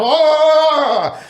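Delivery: a high, loud yelp of surprise; realizing the call is real, startled high oh. Retold or imitated. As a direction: jolt of shock at the realization. Sudden realization.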